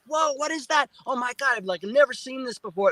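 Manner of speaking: high, awestruck disbelief; amazed whoa. Retold or imitated. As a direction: gasp at lights exploding overhead. Awed at lights in sky.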